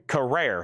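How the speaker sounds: Carrere as kuh-RARE, landing on RARE; corrected pronunciation. Shown as a demonstration. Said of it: "It's pronounced Carrere".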